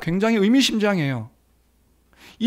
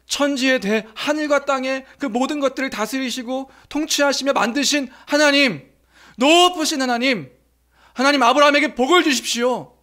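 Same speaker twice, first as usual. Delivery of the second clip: fervent priest's prayer; 5 semitones higher than usual. Acted. Priest Melchizedek blessing Abraham, fervent raised prayer. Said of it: "하나님께 기도하는 거예요".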